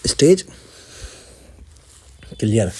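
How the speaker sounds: big jump up on stage, then drops; stage clear announcement. Real, not acted. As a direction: call it out like a winner. (Stage clear का ऐलान)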